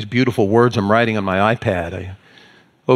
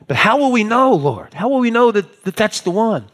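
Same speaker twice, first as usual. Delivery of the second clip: anxious questioning; 9 semitones higher than usual. Acted. Disciple asking the Lord how we will know, anxious questioning. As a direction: plead for an answer, pitched up.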